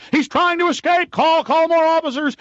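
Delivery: high, loud imitation of a guard's yell; mock guard yelling. Guard yells as he sits in the bucket chair: mock loud yell. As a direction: ham up a panicking guard shouting. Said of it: "And then just yelling".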